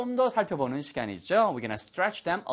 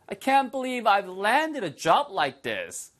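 very slow, higher, marveling; amazed model line; 7 semitones higher, much slower than usual. Shown as a demonstration. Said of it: "repeated model".

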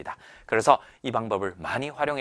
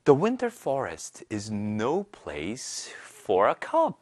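very slow, careful English reading; slow read-aloud model; much slower than usual. Shown as a demonstration. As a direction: read it slowly for students to follow. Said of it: "자, 한번 보도록 할게요".

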